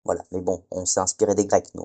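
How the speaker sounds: ordinary talk, nothing marked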